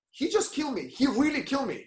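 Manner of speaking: high-pitched mock protest; retold complaint. Dancer complaining to his wife after dying on stage, high-pitched mock protest.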